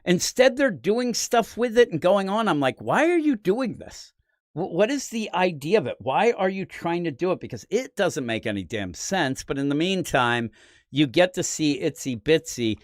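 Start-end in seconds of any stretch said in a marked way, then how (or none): 2.8-7.4 high-pitched, baffled questioning; incredulous, mock-pleading voice; exasperated disbelief. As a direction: plead for an explanation, pitch jumping up. Mock-questioning the villains' baffling plan.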